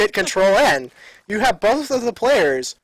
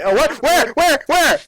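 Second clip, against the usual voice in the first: excited; 9 semitones higher, 5 dB louder than usual. Real, not acted. Excited search for bird.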